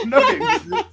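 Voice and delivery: squeaky laughing mock denial; high laughing. Echoes own line, laughing high.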